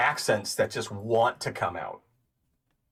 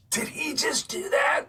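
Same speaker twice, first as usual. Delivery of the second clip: shocked classmates. Retold or imitated. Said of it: "looking at me like".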